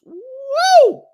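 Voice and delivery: high, loud hype "woo"; excited whoop. Real, not acted. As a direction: let out a big excited whoop. Hype cheer for fight idea.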